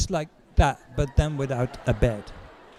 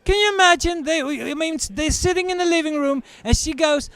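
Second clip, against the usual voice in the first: much higher, fast disbelieving rant; incredulous rant; 11 semitones higher than usual. Real, not acted. Comedian to audience, incredulous and high. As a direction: ramp up the disbelief.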